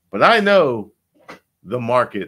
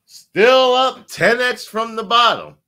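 slow, higher, louder smug founder voice; mocking Richard Heart; 13 semitones higher, 8 dB louder than usual. Mocking Richard Heart boasting to holders after the crash, slow and smug. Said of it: "And then he says".

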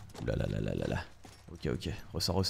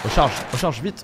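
panicked urging; 7 semitones higher, 6 dB louder than usual. Real, not acted. Reloading under fire.

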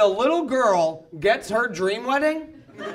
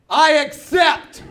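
very slow, loud and higher, knightly; grand acceptance; 4 semitones higher, 6 dB louder, much slower than usual. Retold or imitated. Groom answering the knight's duel, slow loud solemn acceptance. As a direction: accept the duel with mock gravity. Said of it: "And I went".

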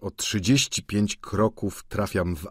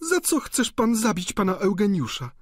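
higher, anxious plea; pleading girl; 12 semitones higher, 4 dB louder than usual. A narrator's reading. Wiktoryna begging Vautrin to spare Eugeniusz, higher and anxious. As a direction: beg him like a scared young girl.

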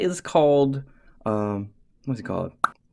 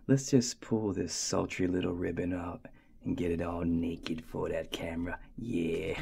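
mock sultry whisper; 6 semitones lower, 8 dB quieter than usual. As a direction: tease it out like a striptease. Mock seductive unboxing.

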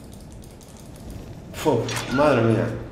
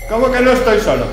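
high, loud alarmed question; startled; 9 semitones higher, 8 dB louder than usual. Real, not acted. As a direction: caught off guard, suddenly alarmed. Startled by game voice.

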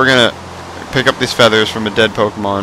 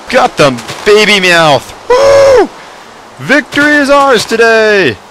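very high, loud victory whoop; triumphant cheer; 8 semitones higher, 4 dB louder, slower than usual. Real, not acted. Gamer celebrating catching Meowth. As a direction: crow over the win.

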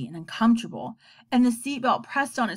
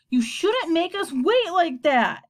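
slow, louder angry outburst; boy snapping at his sister in the car; 7 semitones higher, 4 dB louder, slower than usual. A narrator's reading. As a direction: let it burst out like a held sneeze. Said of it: "Bat's words came out loudly".

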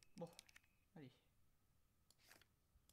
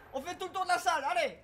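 louder, higher frantic rush; frantic urging; 6 semitones higher, 9 dB louder, faster than usual. Real, not acted. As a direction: push yourself to run. Fleeing the dogs.

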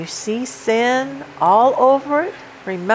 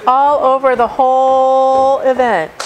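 building preaching; 4 semitones higher, 4 dB louder than usual. Acted. Preaching builds up Martha's busyness.

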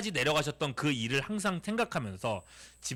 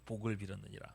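"복을 빌었느니라" repeated lower and much softer; softer echo; 9 semitones lower, 11 dB quieter than usual. Shown as a demonstration. Preacher repeats the verse for the children, softly. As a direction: let the verse fall away quietly.

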